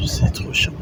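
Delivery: whispered, annoyed; whispered complaint. Real, not acted. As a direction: grumble it under your breath. Annoyed aside, unvoiced. (chuchoté, agacé)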